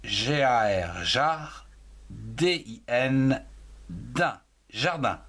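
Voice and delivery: jardin split as G A R jar, D I N din, slowly; syllable spelling. Shown as a demonstration. Teacher spelling jardin syllable by syllable for pupils. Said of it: "un nom commun comme".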